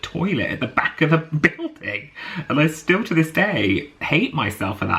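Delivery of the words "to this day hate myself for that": normal talking voice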